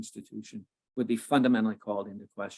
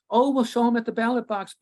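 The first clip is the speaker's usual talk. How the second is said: high, louder parody of naive optimism; mocking imitation; 10 semitones higher, 5 dB louder than usual. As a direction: mimic their glib promise, pitched well up. Mocking the establishment's stock answer.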